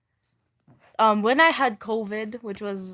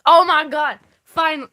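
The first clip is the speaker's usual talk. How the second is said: loud, higher joyful exclamation; relieved excited outburst; 4 semitones higher, 6 dB louder than usual. Real, not acted. Streamer thrilled the chat is finally talking, excited.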